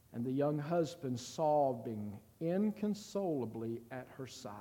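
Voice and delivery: ordinary talk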